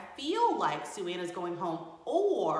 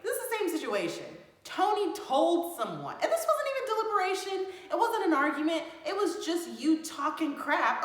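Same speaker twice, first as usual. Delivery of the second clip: higher and louder, building to an outraged shout; heated confrontation; 10 semitones higher, 7 dB louder than usual. Acted. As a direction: call them out, getting angrier line by line. Heated reality-show dinner quarrel.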